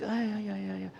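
soft, rapid dismissive babble; mock someone breezing through it; careless skimming. Preacher mocks reading the promises too quickly, careless skimming. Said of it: "don't, don't just".

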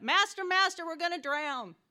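loud, high cry of alarm; scared disciples calling for help; acted panic. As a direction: cry out to wake your master in terror. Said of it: "The disciples get all scared".

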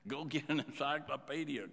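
ordinary talk

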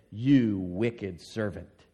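slow, low rebuke; stern master. Acted. Master calling in the servant, slow low rebuke. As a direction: drag out the condemnation. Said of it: "he said".

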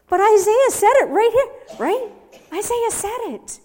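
high, loud burst of conviction; emphatic insistence. Acted. Believer points to Isaiah's prophecy, emphatic insistence. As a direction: insist on it, voice jumping up.

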